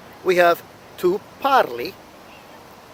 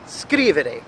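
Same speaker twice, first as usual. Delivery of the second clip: "scrivere" with the weight on SCRI at the start; stress on first syllable; 3 semitones lower than usual. Shown as a demonstration. Teacher contrasting ERE verbs, stressing SCRI in "scrivere". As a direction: hit SCRI in "scrivere", the rest falling away. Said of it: "emphasis falls at the beginning".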